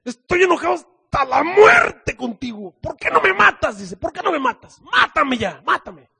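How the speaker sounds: high, loud shouted demand to die; furious Jonah. Acted. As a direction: yell it in full fury. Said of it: "El Jonás dice".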